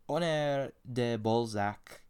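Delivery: slow, exaggerated French accent on the name; a badly faked French accent, drawn out. Shown as a demonstration. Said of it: "That was a terrible accent".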